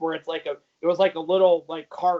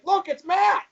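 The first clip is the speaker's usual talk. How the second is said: high, excited imitation of friends pointing him out; teasing shout, jumping high; mock excited call; 13 semitones higher, 3 dB louder than usual. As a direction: call out in pretend discovery, pitch way up. Imitates friends spotting his lookalike on screen.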